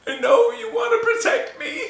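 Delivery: high, laughing mock sob; mock crying. Imitating his wife crying that he won't tell her the truth. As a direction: fake a teary plea while cracking up. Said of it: "she started getting really sad here".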